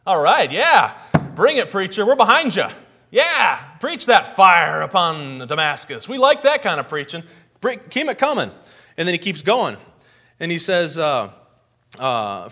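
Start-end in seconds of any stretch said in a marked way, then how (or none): 0.1-8.5 loud, eager shouts of approval; cheering crowd. Retold or imitated. Israelites cheering Amos on, loud and eager. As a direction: egg the preacher on like a fired-up crowd.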